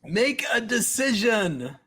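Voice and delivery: high, slow, exasperated shout; impatient yell. Acted. Coach yelling at Nick to decide, exasperated. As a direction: snap at him to hurry up.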